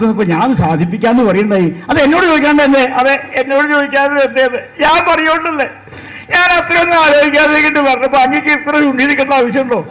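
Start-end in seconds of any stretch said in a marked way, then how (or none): 1.9-4.4 high, put-on quarrelling voice; mock marital reproach in a raised pitch; reproachful spouse. 4.5-9.8 high, put-on wifely whine; mock-tearful complaint, pitched up. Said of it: "എന്തിനാ കരയണേ?".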